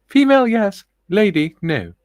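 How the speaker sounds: high, loud put-on lady voice. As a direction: proclaim it like a grand lady. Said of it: "She also says".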